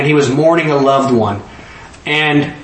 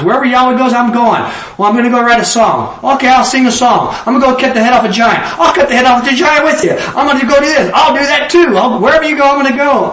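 eager pledge; 8 semitones higher, faster than usual. Retold or imitated. David pledging to follow Yahweh.

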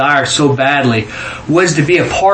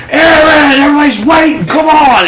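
high, loud calling out; retold impatient yell; 10 semitones higher, 5 dB louder than usual. Storyteller imitating the guy who came to fetch him, high impatient yell. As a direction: yell across to hurry someone along. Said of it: "He yells at me".

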